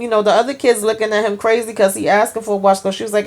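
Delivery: usual speaking voice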